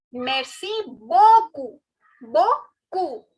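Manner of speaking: slow, loud merci beaucoup ending on koo; silent final P. Shown as a demonstration. Teacher modelling thank you very much, slow and careful. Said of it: "The last P is silent".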